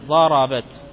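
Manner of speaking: ضربت with no vowel after the final t; still final ta. Shown as a demonstration. Grammar teacher showing the feminine ta, ضربت closed as darabat. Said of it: "تاء التأنيث فهي ساكنة".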